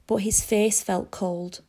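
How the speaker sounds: usual speaking voice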